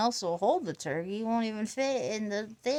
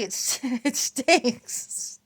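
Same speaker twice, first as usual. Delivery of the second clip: laughing through it; 2 semitones higher, 4 dB louder, much slower than usual. Real, not acted. Laughs mid-quote.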